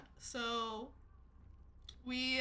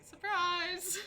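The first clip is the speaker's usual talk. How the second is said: playful surprise; 8 semitones higher, 4 dB louder than usual. Retold or imitated. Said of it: "And I'm like".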